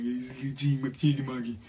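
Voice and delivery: put-on soft, playful baby-talk voice; cooing at a baby. Adult teasing a baby as cheeky monkey, soft cooing. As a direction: quietly babble it to an infant. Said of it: "called a baby a little cheeky monkey".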